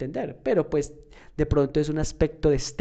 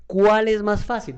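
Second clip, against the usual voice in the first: ¿Cuál es más fácil? asked higher and louder, slow, punching Cuál; emphatic question; 7 semitones higher, 6 dB louder than usual. Shown as a demonstration. Teacher to students, posing the big question loudly. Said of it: "esa es la pregunta".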